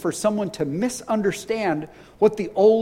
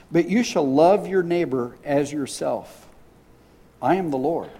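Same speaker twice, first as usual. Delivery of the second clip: lower, measured reading of scripture; 4 semitones lower than usual. Acted. Pastor reading Leviticus aloud to the congregation, measured scripture reading. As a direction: read the verses lower, plainly and deliberately.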